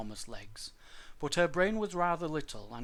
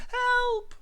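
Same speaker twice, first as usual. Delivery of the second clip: very high, loud squeal; frightened dinosaur's yelp; cry for help; 8 dB louder than usual. A narrator's reading. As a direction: shriek for rescue. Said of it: "the other cried".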